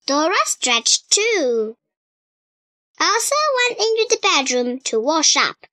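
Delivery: plain reading voice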